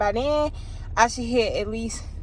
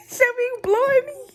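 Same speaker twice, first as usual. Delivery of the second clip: excited squeal; 4 dB louder than usual. Real, not acted. Telling viewers about a shocking book twist, excited squeal. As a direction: voice leaps way up and louder, laughing through it.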